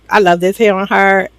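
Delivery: ordinary delivery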